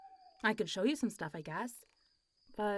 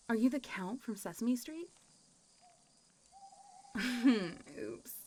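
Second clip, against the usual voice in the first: ordinary talk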